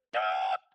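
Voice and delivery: mock heart monitor beep; flatline beep. Preacher imitating hospital monitor going flat, a sharp beep. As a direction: make the flatline sound.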